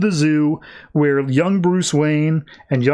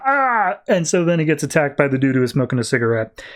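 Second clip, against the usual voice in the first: usual speaking voice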